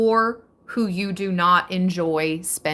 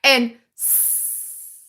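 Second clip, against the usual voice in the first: normal talking voice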